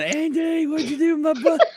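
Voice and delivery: high, loud yell for Andy; retold frantic yell. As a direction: shout the question across the aisles. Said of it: "I'm yelling".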